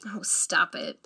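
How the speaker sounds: hushed playful whisper. Acted. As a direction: breathe it out softly, teasing. Playful hushed scolding between lovers.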